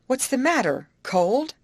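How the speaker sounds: high, startled question; lively worried query; questioning concern. A narrator's reading. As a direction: ask with sudden concern. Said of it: "asked Molly".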